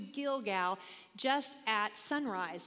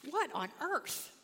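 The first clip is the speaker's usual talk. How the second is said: amazed; 6 semitones higher, slower than usual. Real, not acted. Reacting to the hailstone passage.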